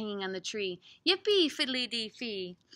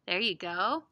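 ordinary delivery, nothing marked

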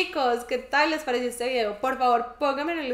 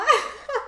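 burst of laughter; 13 semitones higher, 5 dB louder than usual. Real, not acted. Laughs at own imitation.

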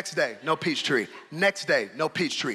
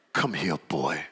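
stern father. Retold or imitated. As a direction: call the boy over, low and serious. Stern father calls son over.

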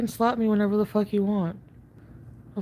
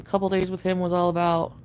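ordinary delivery

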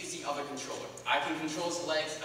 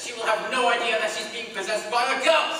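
louder, higher, excited; hyped explanation; 6 semitones higher, 8 dB louder than usual. Acted. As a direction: sell the plan. Confident explanation, energised.

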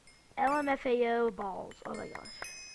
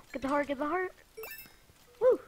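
ordinary talk, nothing marked

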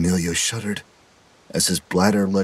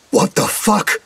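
scream; 14 semitones higher, slower than usual. A narrator's reading. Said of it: "Jeremy screamed".

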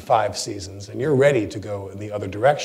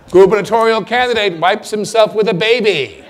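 much higher, louder headline with a laugh; 10 semitones higher, 9 dB louder than usual. Retold or imitated. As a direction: read it out cracking up.

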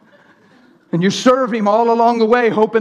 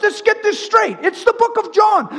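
high, insistent preacher; fervent preaching; 11 semitones higher than usual. Acted. Preacher setting the church straight from John, fervent preaching. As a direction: press the point high and urgently.

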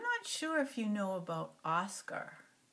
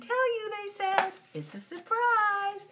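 much higher, slow teasing singsong; sweet sing-song; 13 semitones higher, 4 dB louder, slower than usual. Acted. Ernie and Bert teasing Oscar about the surprise, high and sweet. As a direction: sing it like a happy secret.

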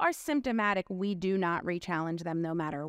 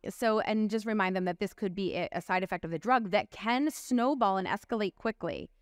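ordinary talk

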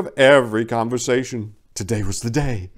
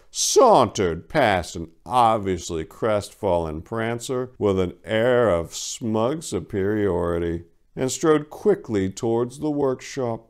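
plain reading voice

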